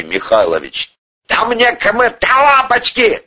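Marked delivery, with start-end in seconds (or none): 1.3-3.2 high, heated outburst; hot-tempered, high-pitched retort; flaring temper. A narrator's reading. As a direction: flare up dismissively. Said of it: "вновь вспылил Порешайло".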